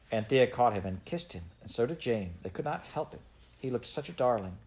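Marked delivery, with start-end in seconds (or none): none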